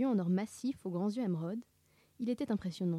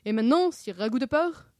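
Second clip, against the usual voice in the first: raised, louder taunt; mock knightly triumph; 4 semitones higher, 7 dB louder than usual. Sammy has cornered Scooby, loudly triumphant. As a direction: corner your foe and declaim it. Sammy coince son adversaire.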